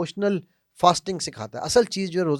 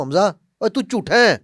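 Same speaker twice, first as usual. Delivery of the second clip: loud, harsh accusation; blunt insult; 4 semitones higher, 9 dB louder than usual. Shown as a demonstration. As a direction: throw the insult bluntly at him. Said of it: "دونوں باتیں ایک ہی ہیں۔ کون سی بری لگی ہے زیادہ؟".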